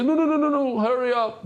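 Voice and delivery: high, pushy cut-off of a rambling litigant; a mock impatient litigant cutting in, pitched high; impatient interrupter. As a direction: hurry him along, way up in pitch. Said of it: "and the other guy say".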